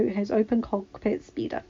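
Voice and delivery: ordinary delivery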